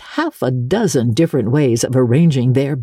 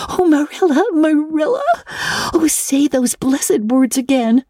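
put-on high, breathless girl's voice, pleading with joy; a young girl bursting with delight, begging to hear it again; overjoyed girl Anne; 14 semitones higher than usual. A narrator's reading.

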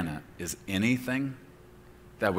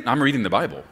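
normal talking voice

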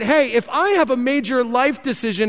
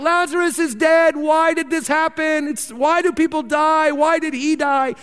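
higher, anxious, pleading voices; freaking-out mourners; 7 semitones higher than usual. Retold or imitated. Mourners at Lazarus' funeral question Jesus, higher and distressed. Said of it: "everyone's freaking out".

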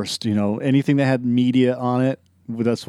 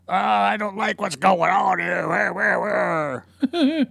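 gravelly Clint Eastwood voice; 9 semitones higher, much slower than usual. Retold or imitated. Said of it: "this gravelly voice sounding like Larry King".

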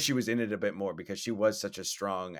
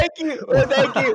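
laughing thanks; 11 dB louder than usual. Real, not acted. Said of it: "I know!".

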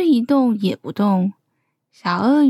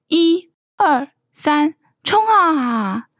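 higher count into a drawn-out charge; slow, higher-pitched storybook rallying call; 5 semitones higher, slower than usual. A narrator's reading. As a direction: launch the race with a stretched "go". Child counts off the train race.

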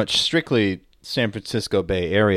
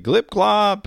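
high squeaky glip glop; silly creature noise; 10 semitones higher, 3 dB louder than usual. Pretend. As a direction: chirp it like a goofy creature. Gobbler creature sound.

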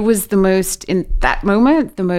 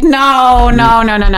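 louder, higher mock protest "no, no, no"; retold refusal; 6 semitones higher, 8 dB louder, slower than usual. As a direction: push the news away with a half-playful loud protest. Refusing the psychic's prophecy.

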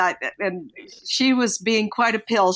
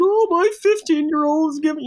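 mopey complaint; 8 semitones higher, 4 dB louder than usual. Retold or imitated. Moping to the grandmother.

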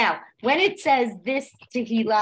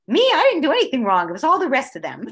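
mock defensive ego; 5 semitones higher, 6 dB louder, faster than usual. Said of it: "your ego wants to get in the way".

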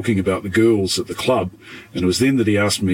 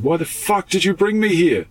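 much higher, mock upset cry; upset Leah; 11 semitones higher than usual. [name] quoting frightened Leah in the car, high and upset. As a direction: voice her distress, pitched way up. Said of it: "she was upset and crying".

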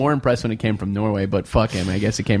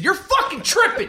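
squeaky high, laughing mock outrage; hammed-up retort to the ref, laughing through it; mock angry retort. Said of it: "I said".

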